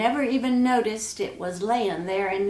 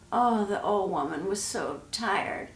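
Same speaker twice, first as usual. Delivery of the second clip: usual speaking voice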